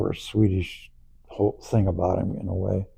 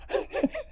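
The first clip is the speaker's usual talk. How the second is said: breathy laugh; 9 dB quieter than usual. Real, not acted. Laughing at own punchline.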